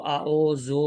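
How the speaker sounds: آ او زو said "a-oh-zo"; majhool mispronunciation. Shown as a demonstration. Teacher shows the spoiled reading, majhool "a-oh-zo" in آ او زو. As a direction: give آ او زو open "oh" vowels. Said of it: "یہاں سے تلفظ خراب ہو جاتا ہے".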